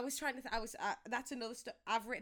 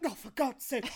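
exasperated granddad; 2 semitones higher, 6 dB louder than usual. Retold or imitated. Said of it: "me granddad just being like".

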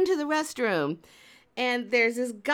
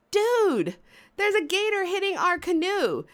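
high-pitched alarmed complaint; mock alarm; 6 semitones higher than usual. Storyteller warns partner of gator hitting canoe, mock alarm. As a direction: imitate your own panicked heads-up.

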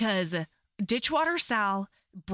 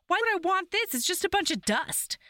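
higher-pitched retold protest; mock indignation; 8 semitones higher than usual. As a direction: play up the baffled complaint. Voices character puzzled at dust.

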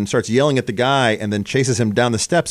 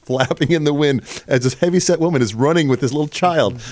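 normal talking voice

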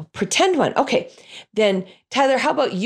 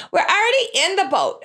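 put-on high little kid's voice, pointing out the obvious; a literal-minded child, pitched up; a small child piping up; kid correcting; 11 semitones higher than usual. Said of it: "kid interrupts the reading".

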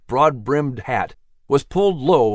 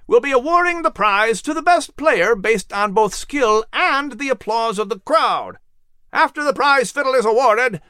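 announcer's call; 10 semitones higher than usual. A narrator's reading. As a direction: announce the rules to a big crowd. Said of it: "The man conducting the event announced the rules".

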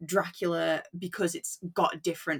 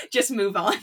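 breaking into a laugh, louder and higher; 4 semitones higher, 8 dB louder than usual. Real, not acted. Reader joking about glossing over seventies references, laughing. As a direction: laugh your way through the words. Laughs while finishing acted thought.

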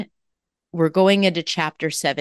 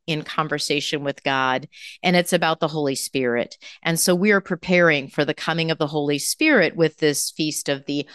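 ordinary delivery

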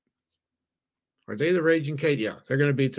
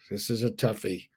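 ordinary talk

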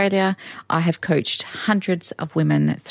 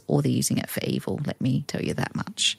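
hushed aside; 4 semitones lower, 6 dB quieter than usual. Real, not acted. Conspiratorial aside about evil coaches.

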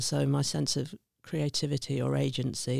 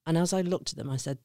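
usual speaking voice

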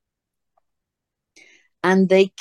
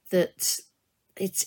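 ordinary talk

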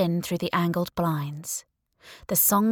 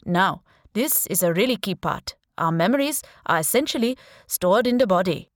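put-on Indian accent; 4 dB louder than usual. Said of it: "he said in a strong Indian accent".

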